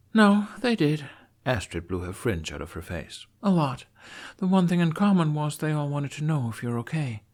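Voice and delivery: a hint of a matter-of-fact young woman; Astrid voice.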